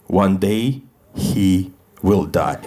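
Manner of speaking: low, very slow doom-laden threat; ominous prophecy. Acted. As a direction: drag it out like a dark prophecy. Opening joke at last Russian shows.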